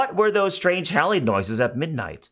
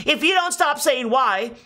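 angry fairy; 10 semitones higher than usual. Acted. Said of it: "the fairy grew pink with anger".

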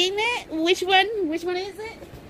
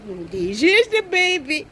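ordinary talk, nothing marked

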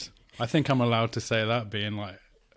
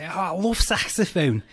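loud, high mock Scouse accent; Liverpudlian; 8 semitones higher, 7 dB louder than usual. Neighbour bursting into a room, loud Liverpudlian accent. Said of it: "Liverpudlian in a flat in Crystal Palace".

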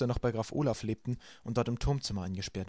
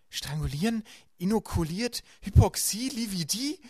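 each word rising higher and more puzzled; baffled repeating; 9 semitones higher, 5 dB louder, slower than usual. A narrator's reading. Violet repeats Klaus's hard words, baffled and rising. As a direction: repeat the strange words in disbelief. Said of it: "wiederholte Violet".

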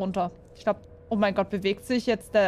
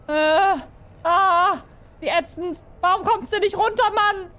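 high loud exasperated yell; frustrated cry; 9 semitones higher, 5 dB louder than usual. Real, not acted. Gamer angry at falling rubble, high loud frustrated yell. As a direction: vent at the stuck game.

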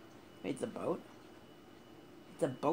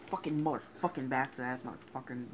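ordinary delivery

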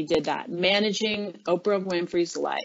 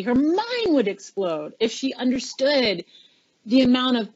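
usual speaking voice

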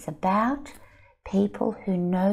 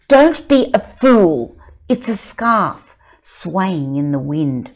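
loud, slow mocking scorn; scornful child; 11 dB louder, slower than usual. Child scolding another, loud and slow. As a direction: call them a fool. Children arguing about the secret.

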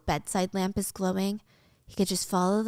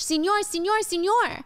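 high, urgent repeated calls; pleading cries, pitched way up; mock screaming in Spanish; 9 semitones higher, 3 dB louder than usual. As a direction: act out a frantic woman hailing a driver. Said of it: "she's screaming in Spanish".

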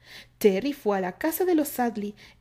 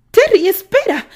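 much higher, loud call; calling after him; 12 semitones higher, 16 dB louder than usual. A narrator's reading. Candy calls after Terry as he gallops away, loud and high. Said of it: "Candy intentó detenerlo".

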